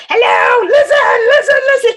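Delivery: high, loud crying shout; shouted demand. Shown as a demonstration. Wife demonstrates shouting at husband to listen. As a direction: yell to be heard, near tears. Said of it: "I have to show this".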